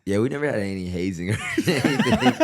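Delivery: laughing through the words; real laugh bubbling up at the end; amused laughing. Real, not acted. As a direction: say it and break into laughter. Joking about a teammate's hazing story.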